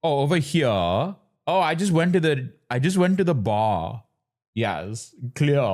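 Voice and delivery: mock posh Indian English accent; posh South Bombay accent, mockingly imitated. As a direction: put on an affected posh accent, dropping the R's. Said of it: "He does not try to do this posh".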